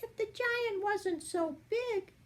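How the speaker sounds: high, light fairy voice; a sly little fairy thinking aloud; fairy's thought. A narrator's reading. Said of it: "she thought".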